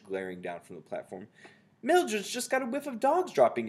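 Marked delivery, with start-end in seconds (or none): 1.8-3.7 very high, loud underling voice; calling back. A narrator's reading. Someone calling back to the Grand High Witch, high call. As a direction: call it up to the leader. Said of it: "someone called back to her".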